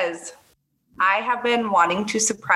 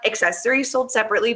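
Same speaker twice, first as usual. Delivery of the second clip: fast disclaimer; faster than usual. Pretend.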